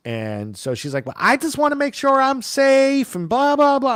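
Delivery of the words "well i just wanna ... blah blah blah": much higher mocking voice of the driver. Mocking the nervous Uber driver, shrill mimicry. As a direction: whine it back mockingly.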